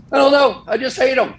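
walking past Giants fans, teasing them; cheeky retort. Retold or imitated. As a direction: toss it off defiantly. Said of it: "I walked by and I said".

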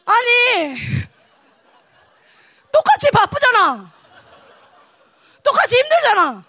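high, loud wail of complaint; hammed-up aggrieved shouting; acted indignant protest. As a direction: protest the unfairness at full voice. Acted protest at unfair treatment.

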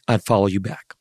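usual speaking voice

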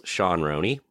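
ordinary talk

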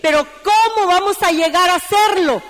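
ordinary delivery

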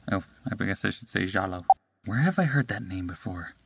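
normal talking voice

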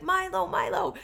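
high, loud imitation of calling a dog; retold yelling. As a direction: call out for a lost dog, pitched high. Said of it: "I could hear him yelling for Milo".

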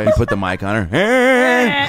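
high drawn-out emphasis; slow emphatic. Real, not acted. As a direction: stretch each word out high.

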